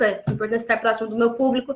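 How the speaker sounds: ordinary delivery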